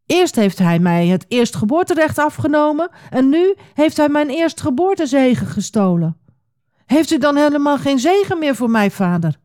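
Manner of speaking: high-pitched, aggrieved protest; mildly acted outrage turning to pleading; indignant outcry. A narrator's reading. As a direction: pour out the grievance, voice rising high. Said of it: "schreeuwde Esau".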